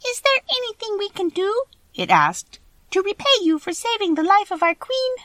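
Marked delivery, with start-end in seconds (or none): 0.0-1.6 very high little mouse voice; high-pitched squeaky field mouse. A narrator's reading. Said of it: "one of the biggest mice spoke". 2.9-5.1 very high little mouse voice; high-pitched squeaky field mouse. A narrator's reading. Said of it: "one of the biggest mice spoke".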